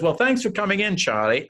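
high, chirpy mock producer voice; mock casual send-off. Producer faking a goodbye to trick Gloria, chirpy. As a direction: put on a breezy fake goodbye. Producer staging a fake meeting.

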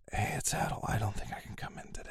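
whispery, weak sick voice; fake sick voice. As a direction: croak it out as if ill. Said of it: "I'll practice my sick voice".

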